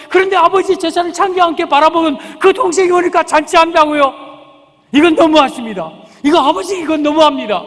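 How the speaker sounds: loud, high indignant protest; aggrieved elder son. Acted. Elder son protesting unfair treatment to his father, angry. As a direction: pour out the grievance, building to a heated shout. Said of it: "그러자 이 큰아들이".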